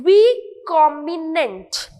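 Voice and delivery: recombinant very slowly in parts, "ree-com-bi-nent"; careful pronunciation. Shown as a demonstration. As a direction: break recombinant into syllables for learners. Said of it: "correctly pronounce करना सीखना है". (recombinant को धीरे-धीरे टुकड़ों में "री-कॉम्बि-नेंट")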